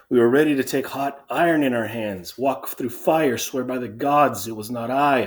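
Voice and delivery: earnest storytelling, building to emphatic denial; sentry's anxious report. Acted. Sentry explains the discovery to the king, earnest and insistent. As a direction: recount it eagerly, stressing innocence. Reads sentry's account in character.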